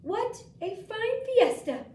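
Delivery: high, loud, slow party guests; delighted guests leaving the fiesta; cheerful guests. Acted. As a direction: sing it out like happy guests. Guests' quote read aloud.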